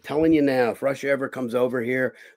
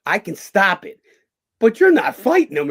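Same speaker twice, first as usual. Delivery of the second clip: raised, loud insistence; reenacted warning, climbing higher and louder; retold firm refusal; 8 semitones higher, 8 dB louder than usual. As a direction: put your foot down loudly. Acts out himself forbidding the fighter.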